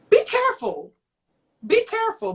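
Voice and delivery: high, sing-song warning, twice; mock warning. Mocking [name] with a high, sing-song warning.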